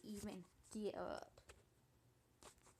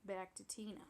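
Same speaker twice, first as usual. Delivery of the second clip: ordinary talk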